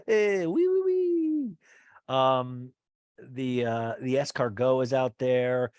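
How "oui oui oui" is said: mock French chef, pitched way up; playful French chef impression; French chef accent. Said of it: "he's talking French".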